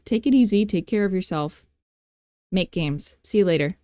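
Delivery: ordinary delivery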